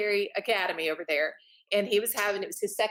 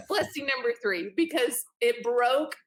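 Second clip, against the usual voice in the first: usual speaking voice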